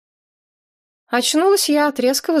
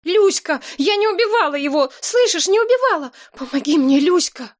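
desperate scream; 10 semitones higher than usual. Acted. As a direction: scream it out, clinging in panic. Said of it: "изо всех сил вцепилась мне в руку и закричала".

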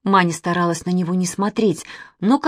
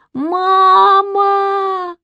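long, high, loud wail; drawn-out shriek of a scared child; terrified scream; 13 semitones higher, 7 dB louder than usual. A narrator's reading. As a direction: scream for mother in panic. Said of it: "закричала Маня".

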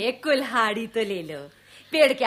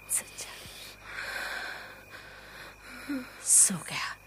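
soft unvoiced whisper; 15 dB quieter than usual. Mother whispering beside her sleeping son. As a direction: hush so the boy stays asleep.

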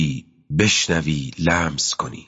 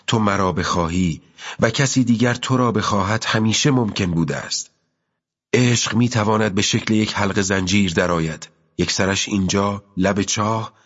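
put-on higher voice for the girl, patient and sure; a young girl explaining love, higher and steady; young girl's voice; 4 semitones higher than usual. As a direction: speak as the girl gently turning down the commander. Girl's long reply to the commander.

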